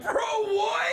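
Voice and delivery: high, loud cry of disbelief; stunned outcry. Retold or imitated. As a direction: yell it like you can't believe your ears. Disbelief at the radio.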